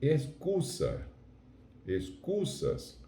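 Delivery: "Excusa", "Excusas" slow, with an unvoiced Spanish s; "Excusa", "Excusas" drawn out, discreet final s; Spanish pronunciation. Shown as a demonstration. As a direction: model "Excusa", "Excusas" slowly, final plural s kept light. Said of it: "ele é quase inexistente".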